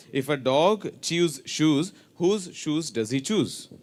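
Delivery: slow, deliberate tongue twister; teacher reading it out slowly; slow demo. Shown as a demonstration. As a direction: model it slowly for them to copy. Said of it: "you have to repeat after me".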